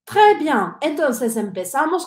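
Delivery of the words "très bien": praise. Real, not acted. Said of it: "praising the class".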